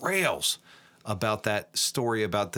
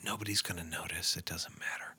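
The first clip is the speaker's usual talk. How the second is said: whispering; 4 semitones lower, 8 dB quieter than usual. Real, not acted. Aside while giving the answer.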